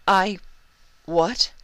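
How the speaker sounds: high, startled splutter; stunned. Acted. Luna caught off guard by her sister's idea, high and startled. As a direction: blurt it out, thrown.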